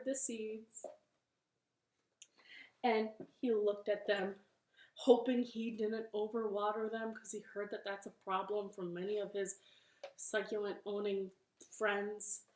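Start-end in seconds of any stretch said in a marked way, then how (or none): none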